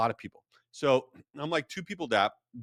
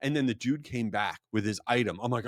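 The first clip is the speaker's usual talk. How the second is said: normal talking voice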